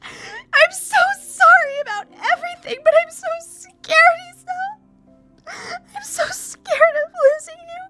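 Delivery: very high, loud, slow wailing sobs; sobbing. Acted. Emmy sobbing apologies against Hisao's chest, wailing. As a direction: bawl it out between gasps, stretching words. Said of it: "begins to sob against my chest".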